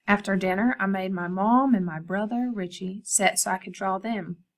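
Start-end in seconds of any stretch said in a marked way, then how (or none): none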